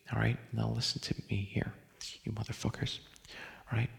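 soft, half-whispered confiding tone; hushed earnest appeal. Acted. Podcaster pleading with his listeners about his duty, hushed and earnest. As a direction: drop right down, intimate and insistent.

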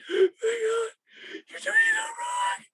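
shrill falsetto wail, crying and shouting; squeal crying man. Retold or imitated. Mocking a producer who sobs over one gobo, high squealing cry. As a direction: wail it like a grown man falling apart. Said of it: "he's just like".

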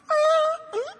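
very high squeal of recognition; squeal, then catch it short; mock gasping squeal. Mary hears her name from the gardener, mock squeal.

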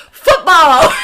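loud, high goofy announcement; goofy Niall shout. Retold or imitated. Host imitates Niall showing the ball, a goofy shout. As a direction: yell it like a silly reveal.

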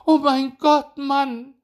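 very high, loud cry of dismay; shrieking wife. A narrator's reading. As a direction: cry out aghast in falsetto. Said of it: "schrie den Igel seine Frau".

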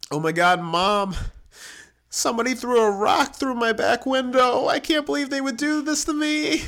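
very high, loud, hammed-up distress; mock hysterics. Teen phoning mom about the broken window, mock hysterics.

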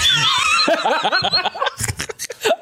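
high, shrieking burst of laughter; squealing laugh. Real, not acted. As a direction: laugh up into a yelp. Giggling over making noise.

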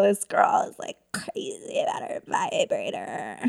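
hushed, whispered imitation of onlookers; mock gossiping whisper. Comedian imitating people talking about her, whispered.

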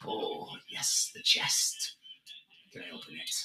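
low, breathy whisper of greed; hushed pirate. Acted. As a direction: lean in and breathe the words, eyeing the chest. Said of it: "and he's like".

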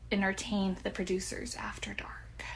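hushed, voiceless tail-off; hushed innuendo. Real, not acted. Host hints at what producers demanded of her, hushed. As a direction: drop to a near whisper. Delicately hinting at casting-couch demands.

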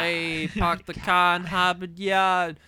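slow, loud, exaggerated Boston accent. Friend making fun of a Boston accent, in thick mock Boston. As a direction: draw it out in mock Boston with dropped Rs. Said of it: "There's the Boston accent".

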